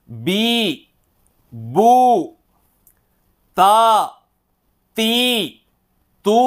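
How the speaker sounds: short syllables one by one, each rising at the end; recitation drill. Shown as a demonstration. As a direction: read each out for the class to echo. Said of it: "డైరెక్ట్గా చదివి నేను వినిపిస్తాను". (ఒక్కొక్క అక్షరం పైకి ఎత్తి చదవడం)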